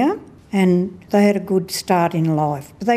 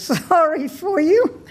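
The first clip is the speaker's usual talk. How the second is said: laughing; 9 semitones higher than usual. Real, not acted. Laughing at own bingo joke.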